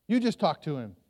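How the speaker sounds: ordinary delivery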